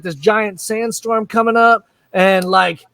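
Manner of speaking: usual speaking voice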